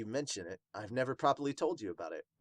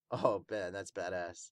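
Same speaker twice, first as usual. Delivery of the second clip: ordinary delivery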